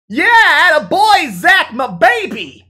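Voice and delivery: very high, loud cheer; cheering friend. Acted. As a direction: whoop for your buddy.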